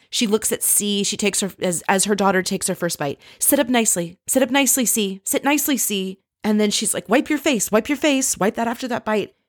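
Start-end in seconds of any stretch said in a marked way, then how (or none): 3.4-6.2 mock nagging mother, higher-pitched; imitated pestering orders to a child. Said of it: "and she nags and nags". 7.1-9.3 high-pitched mock nagging; imitated fussy insistence. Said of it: "and she nags and nags".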